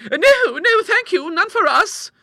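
very high, loud, alarmed protest; high-pitched horrified declining; earnest refusal. A narrator's reading. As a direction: refuse hastily as two flustered ladies.

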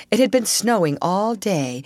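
ordinary delivery

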